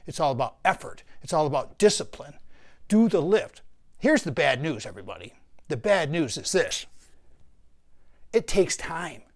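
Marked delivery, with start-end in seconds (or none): 2.9-3.6 higher, louder command; barked motto; loud rallying order. Real, not acted. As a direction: punch the slogan out like a coach. Rallying slogan to viewers.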